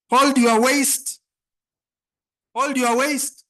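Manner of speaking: loud drawn-out call to the crowd. Real, not acted. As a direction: call it out slowly to the room. MC leading crowd activity.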